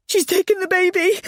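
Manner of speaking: high, loud anguished cry. Acted. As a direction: wail it in despair. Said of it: "I cried".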